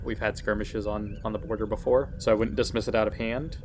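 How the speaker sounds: usual speaking voice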